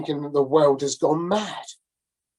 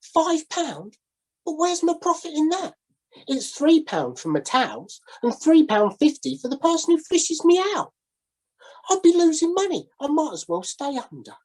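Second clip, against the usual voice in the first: higher, put-upon sailor protesting his losses; indignant sailor; 12 semitones higher than usual. A narrator's reading. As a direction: whine about losing money, pitched up. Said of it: "says the sailor".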